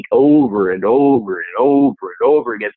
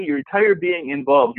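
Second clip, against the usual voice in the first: ordinary talk, nothing marked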